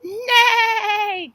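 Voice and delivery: high, loud drawn-out neigh; whinny like a horse for the kids; playful loud horse call. Shown as a demonstration. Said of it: "What sound does a horse make".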